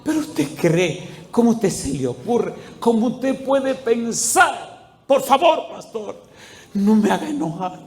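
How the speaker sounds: imitated tearful plea, slow and climbing high; acted weeping protest; tearful, trembling. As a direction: plead as a trembling, crying man, drawing words out. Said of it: "llorando así, tembloroso".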